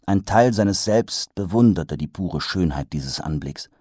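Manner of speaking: usual speaking voice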